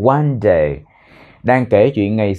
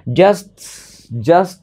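"Just" high and hanging, then a long pause; pause after just; 6 semitones higher than usual. Shown as a demonstration. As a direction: let "Just" rise and hold before going on. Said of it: "giáo viên ngừng rất lâu". ("Just" lên giọng rồi ngừng lâu)

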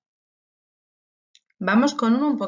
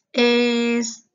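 higher, louder held note; teasing drawn-out suspense; 4 semitones higher, 6 dB louder than usual. Pretend. Teacher about to give pupils the riddle's answer, teasing suspense. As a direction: stretch it out as if about to reveal.

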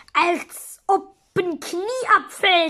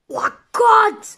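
usual speaking voice

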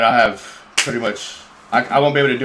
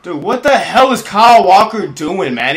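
furious yell, higher and louder; angry shout; 7 semitones higher, 6 dB louder than usual. Real, not acted. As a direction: rage at the screen. Gamer raging at his defender.

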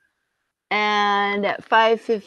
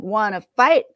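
grouchy challenge; 2 semitones higher, 7 dB louder than usual. Acted. Grouchy ladybug picks a fight.

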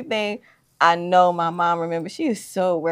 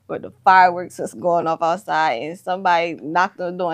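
ordinary talk

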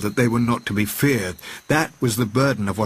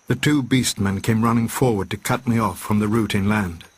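ordinary delivery, nothing marked

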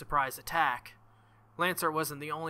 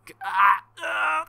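high, loud strangled pain cry; pained yelp; 5 semitones higher, 11 dB louder, slower than usual. Acted. Saber's hand digging into his wound, sharp pain yelp. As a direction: yelp as if something digs into you.

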